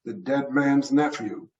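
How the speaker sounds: plain reading voice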